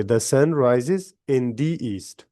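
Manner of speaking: slow model reading, first "the" as duh, second "the" as dee; "The sun" with duh, "the east" with long dee. Shown as a demonstration. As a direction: demonstrate both sounds of "the", slowly. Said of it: "pronounce करेंगे".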